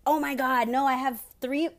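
sudden high, loud flustered exclamation; excited outburst. Real, not acted. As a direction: blurt it out, overwhelmed by the list. Suddenly realizing more topics remain.

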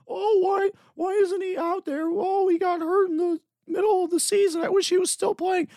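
high, whining mock complaint; whiny fan. Podcaster mocking whining Bears fans, shrill mock whine. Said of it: "whine and cry and say".

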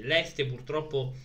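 normal talking voice

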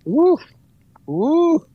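high, loud hype whoops; joyful celebratory woo; excited whoop. Real, not acted. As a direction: cheer her on, way up high. Hyping up the point.

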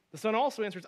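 ordinary delivery

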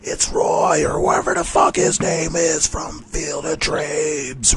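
high-pitched imitation of roaring fans; hammed-up crowd cry, drawn out at the end; cheering crowd. Crowd greets Kevin Costner at the ballpark, cheering wildly. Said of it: "the crowd's applauding".

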